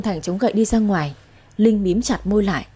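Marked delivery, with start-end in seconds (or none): none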